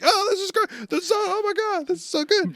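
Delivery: squealing high and delighted; excited crowd voice. Retold or imitated. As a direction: gush like starstruck fans. Everyone cheering Brook, high voice.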